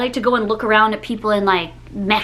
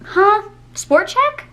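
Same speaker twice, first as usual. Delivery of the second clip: incredulous; 9 semitones higher, much slower than usual. Retold or imitated. Incredulous reaction to wrong name.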